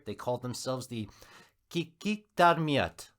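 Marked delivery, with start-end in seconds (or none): 1.7-3.1 Kikiktarmiut spoken slowly and deliberately, each syllable stressed; carefully practiced native name. Shown as a demonstration. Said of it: "I practiced that quite a bit".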